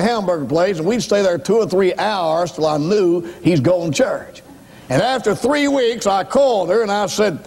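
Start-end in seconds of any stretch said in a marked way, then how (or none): none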